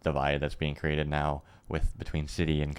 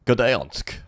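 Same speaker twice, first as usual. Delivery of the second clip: put-on Polish accent; 7 semitones higher, 6 dB louder than usual. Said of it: "please do your best Poland, Polish accent".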